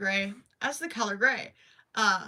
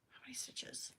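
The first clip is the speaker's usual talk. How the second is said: whispered; 19 dB quieter than usual. Real, not acted. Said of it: "counting stitches to herself".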